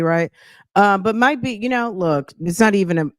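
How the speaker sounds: normal talking voice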